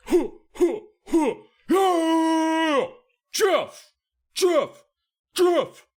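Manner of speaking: very high, loud whooping cheer; victory yell. Acted. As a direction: yell your own name in triumph. Jeff celebrating his win.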